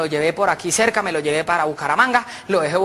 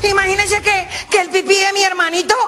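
put-on very high, giggly child voice; little girl; 12 semitones higher, 4 dB louder, slower than usual. Little girl tells mum about her baby brother, little-girl voice. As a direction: pipe up like a small daughter. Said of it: "Llegó una niña donde la mamá".

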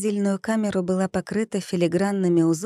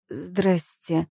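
strangled voice. A narrator's reading. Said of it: "сдавленным голосом проговорила я".

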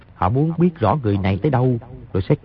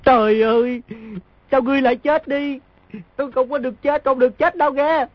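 fake wailing; 11 semitones higher than usual. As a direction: wail high as if mourning a dead friend. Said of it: "Đột nhiên bật tiếng khóc lớn gào lên".

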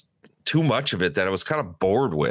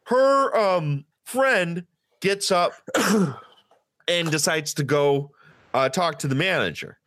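ordinary talk, nothing marked

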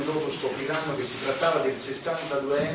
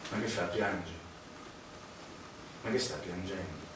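softer, lower scornful question; mocking; 7 semitones lower, 9 dB quieter than usual. Confronting a weeping priest, quiet scornful mockery. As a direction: needle him quietly about his tears.

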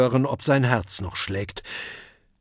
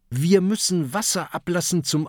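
higher-pitched call to the crew; raised, pressing voice; urgent call; 6 semitones higher, faster than usual. A narrator's reading. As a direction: call out what must be done. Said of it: "ruft Kokosnuss".

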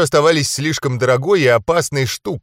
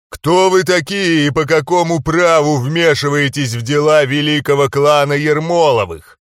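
eerie otherworldly voice, louder; eldritch horror; 2 semitones higher, 4 dB louder than usual. A narrator's reading. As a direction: boom it out like something from beyond. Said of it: "Раздался жуткий потусторонний голос".

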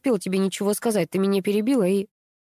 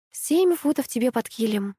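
ordinary delivery, nothing marked

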